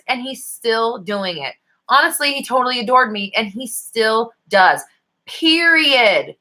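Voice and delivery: slow, insistent stress on still; emphatic and final. Shown as a demonstration. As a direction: still doing it and still does drawn out slowly, Period landing high and firm. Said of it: "period. No comma".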